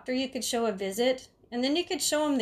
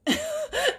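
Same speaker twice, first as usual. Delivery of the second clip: high laugh. Real, not acted. Laughing at the escape.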